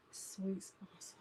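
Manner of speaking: whispered, slow aside; slow whispered aside about the sponsor. Real, not acted. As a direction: breathe it out softly as a secret.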